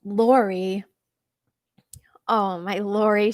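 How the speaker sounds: loud, delighted cry of her name; thrilled out loud by the gift; excited gasp. Real, not acted. Thrilled reaction to a donation.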